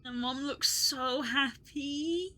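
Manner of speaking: slow, choked-up and tender; tearful. Real, not acted. Streamer moved by her mom's photo, slow and tearful. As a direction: say it slowly as tears come. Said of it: "making me tear up".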